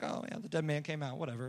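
low, soft offhand mumble; mock indifference. Readers skimming past the miracle, mock indifference. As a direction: shrug it off as old news.

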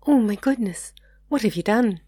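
high-pitched, alarmed concern; genuine concern. A narrator's reading. Margaret to Martin after he cuts himself, concerned. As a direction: gasp it out high, worried for him. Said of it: "asked Margaret with genuine concern".

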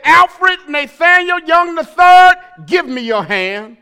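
loud stern mother calling full name; yelling child's full name. Retold or imitated. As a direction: call out the full name, not playing. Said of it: "she wasn't playing".

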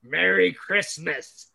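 slow, pointed mock Merry Christmas; mocking someone pointing a finger at him, slow and pointed; pointed mock greeting. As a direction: jab it out slowly like a finger in the face. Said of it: "come up to me with your finger and go".

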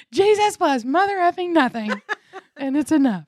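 high, loud laughing slogan shout; mock proclamation. Speaker declaring a slogan for our shirt, high joking shout. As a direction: proclaim it at full volume, amused.

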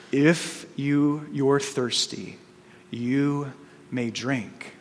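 quiet lion voice. Acted. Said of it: "And then the voice said again".

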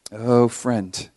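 normal talking voice

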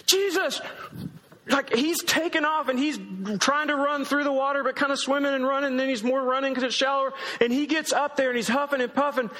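high-pitched, excited rush of narration; excited dash. Acted. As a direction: tell it like you're racing to reach him. Acting out Peter's eager dash.

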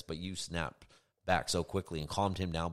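ordinary talk, nothing marked

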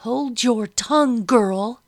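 a mistress's harsh order; commanding. A narrator's reading. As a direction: silence the girl sharply. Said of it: "Madam commanded".

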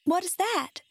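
put-on high little girl's question; curious girl. A narrator's reading. Girl asks the Scarecrow his secret fear, high and curious. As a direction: ask high, eager to know.

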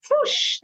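high, airy whoosh; imitated whoosh. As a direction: make the sound of something flying away. Imitates ideals flying out the door.

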